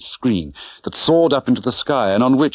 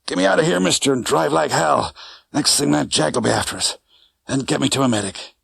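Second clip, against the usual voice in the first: lightly voiced hurt driver, urgent; urgent, hurting taxi driver; wounded cabbie; 5 semitones higher than usual.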